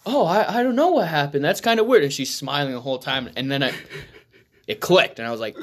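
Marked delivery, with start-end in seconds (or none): 0.1-2.0 innocent feigned voice. Retold or imitated. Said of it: "She's like".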